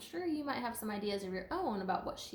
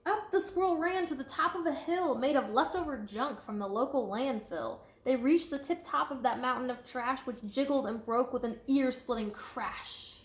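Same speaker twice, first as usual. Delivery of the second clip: lively, sing-song storybook read; reading to children; 3 semitones higher, 7 dB louder than usual. A narrator's reading. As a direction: read it out to a class with bounce. Reading picture book aloud.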